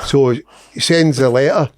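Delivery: ordinary talk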